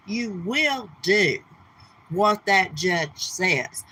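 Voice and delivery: very slow, every word laid down; slow emphatic. Real, not acted. Streamer lecturing Missus Portland, slow and emphatic. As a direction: spell it out word by word.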